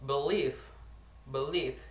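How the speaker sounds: "believe" with the stress on LIEVE; teacher's model of "believe", end stressed; stress on second syllable. Shown as a demonstration. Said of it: "the syllable stress that is believe".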